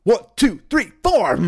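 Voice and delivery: loud, high count-in; mock father's message. Comedian passing on a musician father's advice to his son, loud high count-in. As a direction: count it off hard and high like a band leader. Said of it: "Here's what he said".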